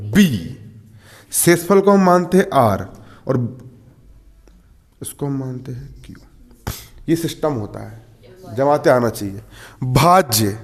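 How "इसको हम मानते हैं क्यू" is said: whispered, fast aside. Real, not acted. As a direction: breathe it out as a secret.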